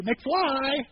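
a hint of Biff's taunting McFly; quick mock knock on the head; movie quote. A bully teasing McFly, lightly voiced. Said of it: "remember Back to the Future?".

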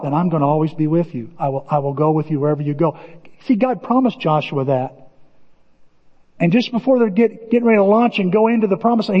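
normal talking voice

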